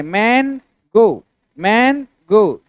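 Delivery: "मैन गो" slowly, a clear gap between the two; syllable by syllable. Shown as a demonstration. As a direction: draw out "मैन", pause, then "गो". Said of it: "इसमें syllable है". ("मैन गो" को धीरे, दो अलग हिस्सों में बोलें)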